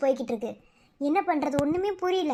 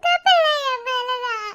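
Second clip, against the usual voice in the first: high tearful wail; crying; 8 semitones higher, 4 dB louder, slower than usual. Acted. Wife weeping over her injured husband, crying. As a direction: sob it out, blaming herself.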